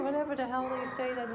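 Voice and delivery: ordinary talk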